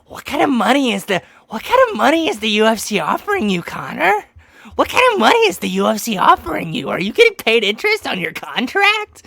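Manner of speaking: hammed-up reporter; mock nagging interviewer. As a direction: badger him about money. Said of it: "Ariel's like".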